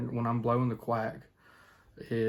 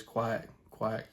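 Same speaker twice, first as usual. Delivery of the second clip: soft quack demo; 5 dB quieter than usual. Shown as a demonstration. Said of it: "it's just what it is".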